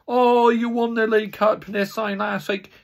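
mock rival fan. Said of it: "You have rival fans saying".

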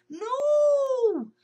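very high, loud wailing no; trapped squirrel moaning. Acted. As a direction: wail it in despair. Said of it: "heard Shelley moan".

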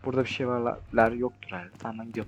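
very soft, low self-talk; barely voiced, cautious murmur; hushed. Real, not acted. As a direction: keep your voice down while hiding. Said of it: "Sessiz de olmam lazım".

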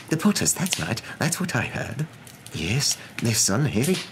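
lightly voiced strangers trading news; whispering gossips. Strangers gossiping about the Potters, excited murmur.

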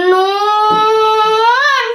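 নুন held for three alif lengths, long and even; long drawn noon. Shown as a demonstration. Teacher showing students how নুন is held long. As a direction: stretch নুন out slowly like Quran recitation. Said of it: "তিন আলিফ টেনে পড়তে হবে".

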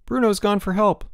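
high, nervous reassurance; gulped. A narrator's reading. Boots telling the stranded boy help comes, gulping. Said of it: "gulped Boots".

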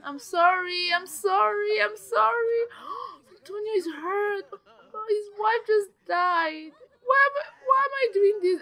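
high, whimpering wail with gasps; overwrought, high-pitched lament; distraught wailing. Real, not acted. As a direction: plead for forgiveness, voice climbing high. Apologising to grieving Sims, distraught.

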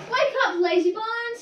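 high, loud wake-up call; cheeky whistling engine. A narrator's reading. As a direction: startle a sleeper with cheek. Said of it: "came up in a cheeky way".

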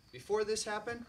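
ordinary delivery

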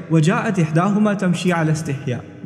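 usual speaking voice

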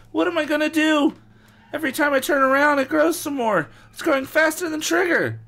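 put-on high, loud panicked boy; high hammed-up kid panic; panicked Evan. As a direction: wail it like a kid in over his head. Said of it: "Evan cried".

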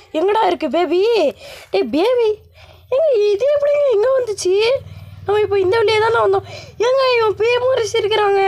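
put-on high frightened exclamation at the creepy baby; spooked. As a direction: sound truly creeped out by the baby.

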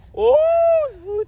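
a loud, high shocked "ôi"; hammed-up cry of shock; dramatic gasp. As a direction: gasp it out like a soap heroine. Melodramatic doll quarrel.

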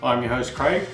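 ordinary talk, nothing marked

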